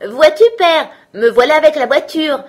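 put-on high, excited small-boy shout; high-pitched holler, loud at first then easing; calling out. A narrator's reading. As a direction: call proudly to father, high and loud. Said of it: "il lui cria".

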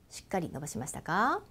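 ordinary talk, nothing marked

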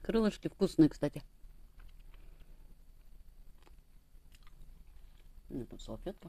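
nibbling meat off bone; chewing while talking. Real, not acted. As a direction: mouth partly full. Seen 1: Picks meat off bone at mouth, chews between and during words.

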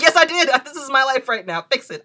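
high and loud, laughing through frustration; loud frantic demand. Retold or imitated. Telling the nurse to fix it, loud and frantic. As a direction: blurt it at her, at the end of your rope.

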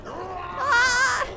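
loud high shriek; terrified scream. Real, not acted. Monster attack. (испуганный крик)

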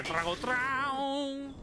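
long, high sung-out guitar twang; imitated guitar strum. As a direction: vocalize a drawn-out electric guitar chord. (imitación de guitarrazo)

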